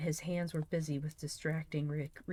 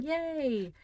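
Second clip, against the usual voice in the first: high, bright cheer; cheery yay; 11 semitones higher, 4 dB louder than usual. Real, not acted. Streamer cheering at a viewer's comment. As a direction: squeal a happy yay.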